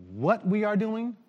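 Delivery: normal talking voice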